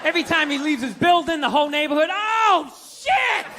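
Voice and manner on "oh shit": loud jeering "Oh, shit!"; mocking shout. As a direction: jeer at him like the whole block. Neighborhood mocking his sneakers.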